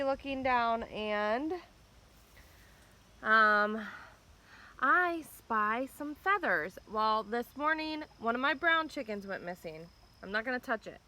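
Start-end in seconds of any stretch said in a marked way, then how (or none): 4.8-6.8 slow and drawn out, pitched up; slow dramatic reveal. Real, not acted. Vlogger slowly reveals the feathers to viewers, drawn out. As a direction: draw out each word like a suspense reveal. Repeating the discovery for camera.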